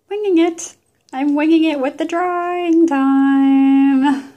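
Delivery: high, loud sing-song wink; cheeky proud announcement, slow; playful exclaim. Real, not acted. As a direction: belt it out like a silly motto. Playful exclaiming about guessing.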